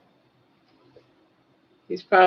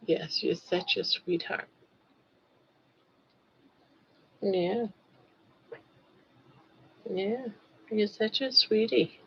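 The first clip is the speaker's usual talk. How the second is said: talking to a dog; 10 dB quieter than usual. Real, not acted. Soft cooing to her dog.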